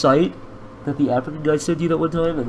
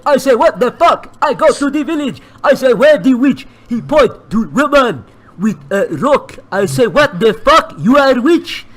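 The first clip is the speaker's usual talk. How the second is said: lightly voiced broken-English villager telling a tale; mock broken-English storyteller; 9 semitones higher, 9 dB louder than usual. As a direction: spin the witch story in a hammed-up foreign villager voice. Tells story in put-on accent.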